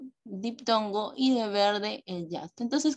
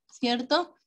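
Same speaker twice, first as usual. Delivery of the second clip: usual speaking voice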